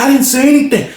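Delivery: high, whiny denial; Rob denying. Retold or imitated. Rob still denying, high whiny voice. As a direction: protest as he walks up.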